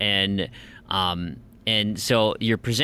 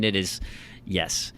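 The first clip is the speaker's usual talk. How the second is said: ordinary talk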